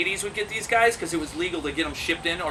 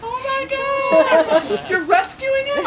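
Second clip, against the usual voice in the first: high, loud mock squeal; gushing amazement; 5 dB louder, much slower than usual. Coffee girl hearing of a rescue, mock gushing. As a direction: gush it in a comic high shriek.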